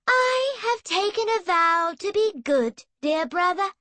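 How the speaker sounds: high, saintly sister's voice; pious and sweet. Acted. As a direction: play the holy little saint for her brother. Emily pious, sugary tone.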